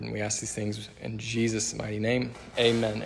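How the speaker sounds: soft, hushed prayer before the sermon; quiet prayer. Acted. Pastor prays before the sermon.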